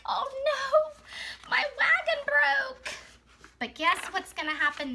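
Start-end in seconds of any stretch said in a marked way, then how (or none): 0.0-2.9 very high-pitched mock wail; Worm crying. Teacher acts out Worm over the broken wagon, mock crying. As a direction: act out a hammed-up tearful lament. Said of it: "He's crying. He's like".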